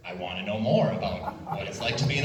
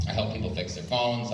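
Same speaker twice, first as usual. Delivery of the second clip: ordinary delivery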